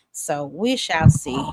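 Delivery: slow, drawn-out catchphrase. Real, not acted. Host drawing out her catchphrase to viewers, slowly. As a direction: let each word hang, teasing.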